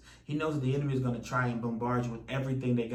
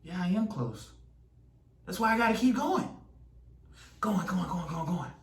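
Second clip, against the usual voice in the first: determined self-urging; 5 semitones higher than usual. Acted. Runner answers, urges self to goal.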